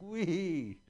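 high thrilled wee from the coaster; mock gleeful squeal. Rider on the coaster above the bathhouse, squealing wee. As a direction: squeal it like a kid on a ride. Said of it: "You're like".

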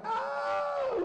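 very high, held mock wail; mock scream. Tourists as their truck flips over, mock screaming. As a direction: imitate panicked tourists with a sustained high cry.

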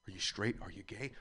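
normal talking voice

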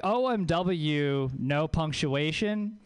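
slow, measured "O M W"; "O M W, no punctuation" drawn out with dignity; dignified. Shown as a demonstration. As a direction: spell out "O M W" slowly and coolly. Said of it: "that has some dignity to it".

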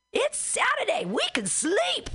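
high, slow, dazed protest; mock high voice of a bewildered woman; confused naked lady. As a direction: whine it like a confused woman just woken. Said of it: "she's like".

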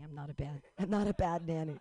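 normal talking voice

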